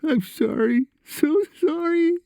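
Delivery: very high whine rising to a squeak; tearful whine. A narrator's reading. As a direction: whimper it high, slowing as the tears come. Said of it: "Josh whined, ending in a squeak as he broke into tears".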